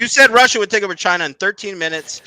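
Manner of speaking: high, loud incredulous yell; exasperated shout. Real, not acted. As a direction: shout it back at him in disbelief. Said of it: "Are you gonna do baby voice next?".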